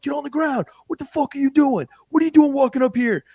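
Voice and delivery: high, loud mock cop yell; imitating yelling cops. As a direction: bark it like cops drawing down on someone. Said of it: "they're yelling outside the ambulance".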